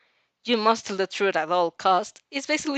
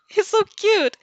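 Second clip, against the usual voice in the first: gushing; 12 semitones higher, 6 dB louder than usual. A narrator's reading. Narrator gushing over squirrel.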